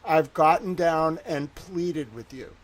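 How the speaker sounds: normal talking voice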